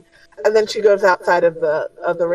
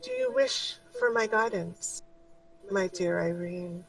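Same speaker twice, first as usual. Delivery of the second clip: kind and compassionate; 9 dB quieter than usual. Acted. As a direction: gently offer guidance. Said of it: "in a very kind and compassionate tone".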